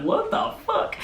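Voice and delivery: very high, laughing mock bewilderment; mock disbelief. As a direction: imitate baffled friends in a squeaky-high voice. Retold disbelief at friend's outburst.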